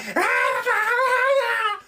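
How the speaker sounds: very high mock shriek; shrill imitated screaming; mum's shrieking. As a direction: imitate a wild screech. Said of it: "The mum was like".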